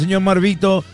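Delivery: ordinary talk, nothing marked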